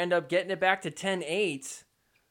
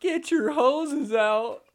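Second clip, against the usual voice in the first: drawn-out, higher playful call; slow call-out; 8 semitones higher, much slower than usual. Acted. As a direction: call it out slowly and high. Calling out firefight.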